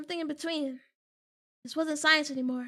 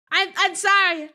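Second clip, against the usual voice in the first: loud mock-panicked apology; 2 semitones higher, 7 dB louder than usual. Real, not acted. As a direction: blurt out sorry loudly. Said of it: "I will never speak the name".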